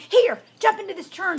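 high, loud, urgent order; urgent command. A narrator's reading. Pig orders the fox into the churn, urgent. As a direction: hurry someone into hiding.

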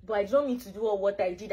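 ordinary delivery

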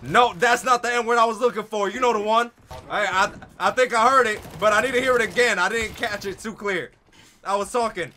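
high, loud insistent pestering; loud goading. Real, not acted. Gamer goading a stranger over voice chat, loud insistence. Goading another player to repeat himself.

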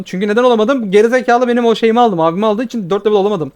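venting real fury at a teammate who stole his camp; furious rant. Real, not acted. Gamer raging at the thief who cost him a level, loud and furious. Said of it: "Kuduruyorum sinirden şu an".

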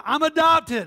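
loud and proud, a laugh in it; loud declaration. Shown as a demonstration. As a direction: shout it out, laughing. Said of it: "Say this with me loud".

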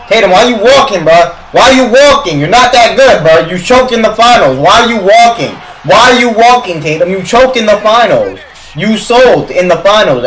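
real fed-up fan yelling at the team's star; heated scolding. Real, not acted. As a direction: berate the player in exasperation. Scolding Tatum during live game.

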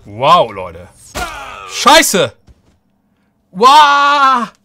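loud, high shocked yells; excited shouting. Real, not acted. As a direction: explode with disbelief at the win. Überraschender Sieg im Kampf. (aufgeregt schreiend)

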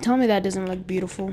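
ordinary talk, nothing marked

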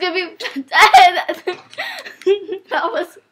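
words broken by real laughter; laughing. Real, not acted. Laughing during game.

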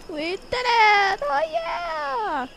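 drawn-out cheer climbing high; triumphant cheer. Pretend. Player safely home, cheering in triumph. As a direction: celebrate with a long whoop.

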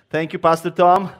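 ordinary talk

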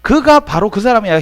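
ordinary talk, nothing marked

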